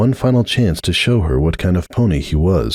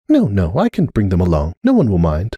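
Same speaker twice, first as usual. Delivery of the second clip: usual speaking voice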